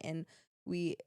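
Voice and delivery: ordinary talk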